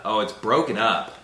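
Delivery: ordinary talk, nothing marked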